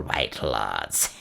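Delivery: low, far-off croak of a hag; croaking old witch. A narrator's reading. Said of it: "croaked Gagul".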